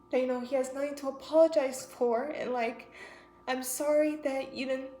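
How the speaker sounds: talking through tears; crying. Real, not acted. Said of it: "I was not expecting to cry like that".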